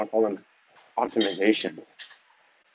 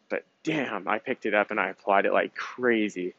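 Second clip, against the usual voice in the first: ordinary talk, nothing marked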